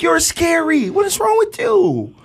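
high-pitched, incredulous scolding; amused exasperation. Retold or imitated. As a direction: scold your scared son, voice way up. Mock-exasperated parent, laughing.

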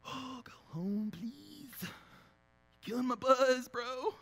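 mock annoyed friend, slow and dragged out; a hammed-up buzzkill complaint. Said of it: "people are going".

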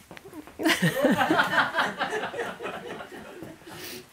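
long high peal of laughter; helpless high laughter; big laugh. Real, not acted. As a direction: burst out laughing at the memory. Husband caught mowing her flowers.

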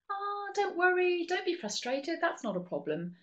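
high, breezy brush-off; chirpy fake reassurance that waves the feeling away; dismissive reassurance. Shown as a demonstration. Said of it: "You're not trying to say".

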